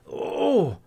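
loud, high startled "oh"; sudden exclamation of shock; startled cry. A narrator's reading. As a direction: jolt awake with a cry. Grandpa startled awake.

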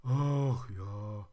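loud, drawn-out theatrical sigh; acted, voiced sigh. A narrator's reading. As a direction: sigh the words out as a teddy. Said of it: "Er seufzte".

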